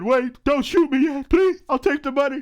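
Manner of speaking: high, loud panicked plea at gunpoint; mock pleading. Victim with a gun pointed at him, in a hammed-up panicked plea. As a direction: beg for your life, hammed-up and rising.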